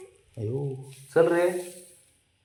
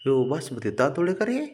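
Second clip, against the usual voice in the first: normal talking voice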